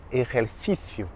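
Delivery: "ejercicio" with a throaty j and th for each c; C interdental espagnol. Shown as a demonstration. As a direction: say "ejercicio" the Castilian way, tongue between teeth on c. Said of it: "la langue entre les dents". (« ejercicio » à l'espagnole, c entre les dents)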